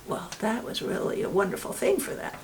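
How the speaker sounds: ordinary delivery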